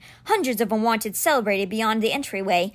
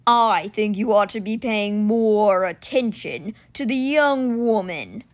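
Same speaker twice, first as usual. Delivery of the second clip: growling beast; slower than usual. Acted. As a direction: growl it out slowly like a huge winged cat. Simber the stone beast speaks.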